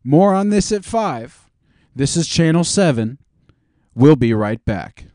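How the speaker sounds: news anchor. Acted. Anchor sending viewers to commercials, mock newscast voice. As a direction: toss to the break like an anchor.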